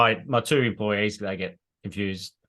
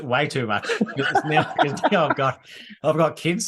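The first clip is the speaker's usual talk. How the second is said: words bubbling with laughter, a bit louder; laughing; 6 semitones higher, 4 dB louder than usual. Real, not acted. Guest laughing about pranking his own kids. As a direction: laugh through it as you confess.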